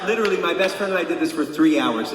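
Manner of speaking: ordinary talk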